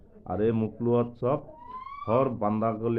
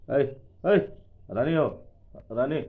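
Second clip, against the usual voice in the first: calling out; 5 semitones higher, 4 dB louder than usual. Real, not acted. Calling an animal by name.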